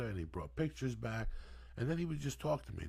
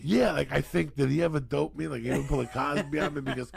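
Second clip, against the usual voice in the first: louder, laughing through the words; 4 semitones higher, 8 dB louder than usual. Real, not acted. Telling friends about the neighbor, laughing loudly. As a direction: giggle while admitting the doubt. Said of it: "I love that you're not sure".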